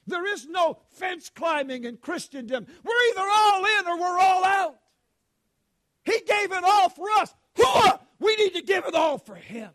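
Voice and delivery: loud, high shouted exhortation; full-force preaching. Acted. Preacher rousing the congregation at full force.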